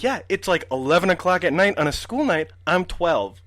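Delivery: high-pitched retold protest; exasperated retort. Twelve-year-old protests to grandma late at night, retold exasperation.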